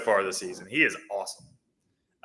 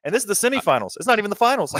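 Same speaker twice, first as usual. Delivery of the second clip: excited; 11 semitones higher, 4 dB louder, faster than usual. Real, not acted. Marvelling at the stacked semifinal.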